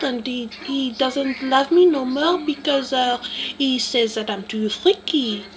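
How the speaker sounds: a light French accent, playing sultry; lightly voiced lovesick French woman. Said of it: "Marie Claire from France".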